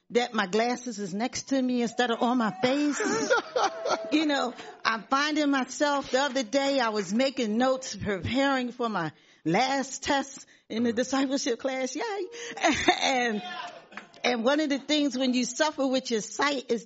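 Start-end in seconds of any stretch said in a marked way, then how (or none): 3.0-4.1 amused high chuckle; high laugh. Real, not acted. Glasses not on face. 12.1-13.0 high laugh near tears; teary laugh. Real, not acted. After "Yay.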